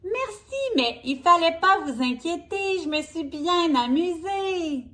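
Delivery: high, bright-voiced Berk; Berk cheerful. Berk reassures her friends she had fun, high and cheerful. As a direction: answer lightly as carefree Berk. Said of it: "a répondu Berk".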